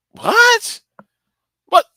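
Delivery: a very high squeak of disbelief; shocked squeak. Real, not acted. Shocked at Alfred getting shot.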